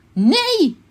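high, emphatic refusal; loud repeat. A narrator's reading. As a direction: call it out for someone who missed it.

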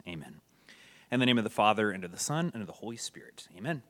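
solemn, measured prayer recitation. Acted. Opening prayer before the class.

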